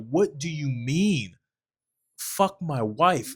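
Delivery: drawn out, baffled husband; lightly voiced stunned husband; slow stunned disbelief. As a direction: let the shock stretch each word. [name] in shocked disbelief.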